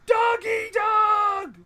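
high, stretched holler; yelling. Acted. Answering why he yells at them, still yelling. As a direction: shout it high and long. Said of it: "Why are you yelling at us?".

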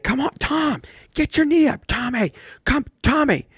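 imitated dad coach hollering at his pitcher son, high; imitating a yelling dad. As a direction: bark orders at your son from the dugout. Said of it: "he would just yell at him".

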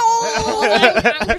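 lightly voiced creaky old man, pitched high; a hint of doddering old Jafar, sudden burst. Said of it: "he's just making that old man voice".

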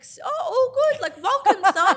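high, loud, gushing family welcome; mock gushing welcome. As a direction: ham up the delighted relatives, high and bright. Mock family welcoming a son-in-law.

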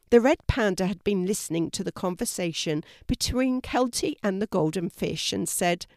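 ordinary delivery, nothing marked

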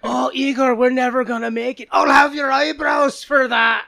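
hammy Scottish-Russian mountaineer shouting; Scottish Russian accent. Acted. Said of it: "a bunch of Russians with Scottish accents".

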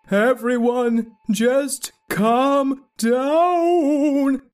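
slow, drawn-out calm down. Acted. Skeletony calming the panicked crowd, slow and drawn out. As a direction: stretch it out to soothe them.

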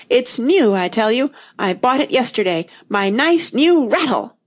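indignant protest, lifting at the end; rising furious cry. A narrator's reading. Tweedledum insists his rattle was new, rising furious cry. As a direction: build toward a high wail without fully screaming. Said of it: "his voice rose to a perfect scream".

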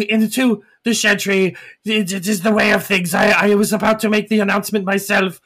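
high, stammering gargoyle excuse; nervous gargoyle. Acted. Rex nervously answering elders.

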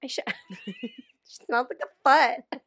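laughing through it, slow. Real, not acted. Repeating her friend's funny quote about her, laughing. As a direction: crack up while repeating the line.

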